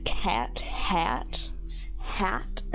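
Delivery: cat and hat with a hard Midwest flat A; aggressive A's. Shown as a demonstration. Streamer showing the A's they get teased for. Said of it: "the way I say my A's".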